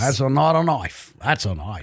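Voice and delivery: mock Aussie accent, dropping low; Australian accent. Shown as a demonstration. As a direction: do a jokey Australian movie quote. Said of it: "Can you say anything else?".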